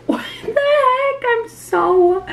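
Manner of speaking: very high, drawn-out disbelieving wail; shocked squeal. Real, not acted. Vlogger seeing her bleached hair, high shocked squeal. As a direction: squeal it slowly in real horror.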